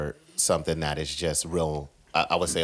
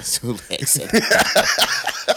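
slow laughing echo of the order; 8 semitones higher, 7 dB louder, much slower than usual. Retold or imitated. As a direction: repeat it through laughter. Repeats friend's order laughing.

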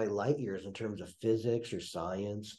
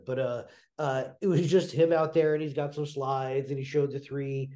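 ordinary talk

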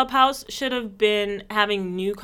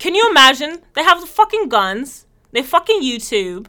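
mock British rant; 5 semitones higher, 8 dB louder than usual.

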